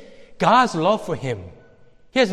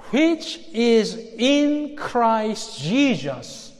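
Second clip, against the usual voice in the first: drawn-out, word-by-word reading; measured and deliberate scripture reading; very slow, careful reading; much slower than usual. Shown as a demonstration. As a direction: read it slowly, letting each word stand. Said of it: "read that very, very slowly and carefully".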